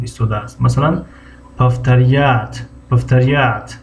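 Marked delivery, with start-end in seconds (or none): 1.6-3.8 "повторят" with the stress on the final "я"; "повторят" stressed on "я", slow. Shown as a demonstration. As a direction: say "повторят" slowly, leaning on "-рят". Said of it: "ударение дар ин я ҳаст".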